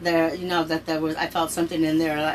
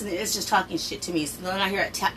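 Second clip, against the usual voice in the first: ordinary talk, nothing marked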